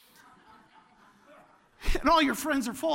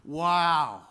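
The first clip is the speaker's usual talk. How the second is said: wow; 6 semitones lower, 5 dB louder than usual. Shown as a demonstration. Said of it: "you say that".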